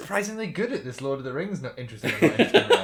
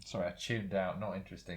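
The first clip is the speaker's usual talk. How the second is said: soft, low bored reply; 7 semitones lower, 10 dB quieter than usual. Real, not acted. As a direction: mumble it, totally uninterested. Bored answer after tuning out.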